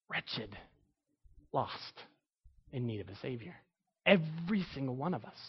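hushed solemn. Acted. Solemn answer about humanity.

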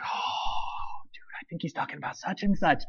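hushed gossiping whisper. Retold or imitated. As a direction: whisper it to the person next to you. Congregation gossiping during sermon.

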